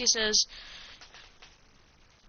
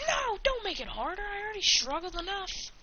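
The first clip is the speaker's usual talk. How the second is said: mock yell; 10 semitones higher than usual. Naruto protesting a harder exam in a high mock yell. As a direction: yell it in a high voice without real volume. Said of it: "Naruto yells".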